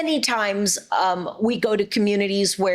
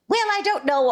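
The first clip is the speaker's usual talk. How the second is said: a sudden high put-on cartoon voice; tossed-off cartoon line, pitched up; character voice; 12 semitones higher than usual. Shown as a demonstration. A quick cartoon line instead of a joke. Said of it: "easier for me to just go".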